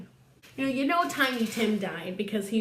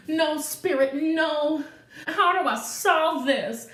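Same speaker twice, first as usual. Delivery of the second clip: mock desperate plea; 6 semitones higher, 5 dB louder, slower than usual. Scrooge begging at the grave.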